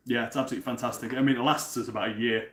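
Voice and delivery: ordinary delivery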